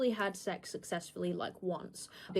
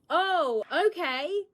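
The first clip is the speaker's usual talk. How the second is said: surprised oh okay; 8 semitones higher, 5 dB louder, much slower than usual. Retold or imitated. Surprised by his love confession.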